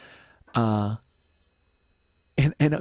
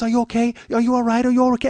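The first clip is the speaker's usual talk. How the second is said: high, loud fussing woman's voice; mock-worried, higher and louder; concerned woman; 10 semitones higher, 6 dB louder, faster than usual. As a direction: imitate her anxious questions, pitched up.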